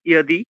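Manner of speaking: ইয়াদি with a clear ইয় glide at the start; Sanskrit ইয়াদি. Shown as a demonstration. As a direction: begin ইয়াদি with a ইয় sound, not জ. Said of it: "এইরকম উচ্চারণটা হবে".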